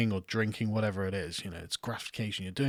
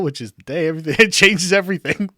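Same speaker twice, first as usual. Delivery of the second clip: laughing; 7 semitones higher, 8 dB louder than usual. Real, not acted. Laughing while reading a comment.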